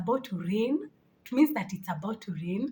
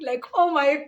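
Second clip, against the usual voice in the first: laughing hard; 6 semitones higher, 3 dB louder than usual. Real, not acted. Laughing at the eye myth.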